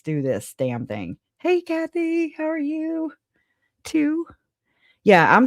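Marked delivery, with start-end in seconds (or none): 1.4-4.3 high, bright sing-song hello to a viewer; high sing-song welcome to a viewer in the chat; sing-song greeting. Real, not acted. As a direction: greet her up high like a cheery host.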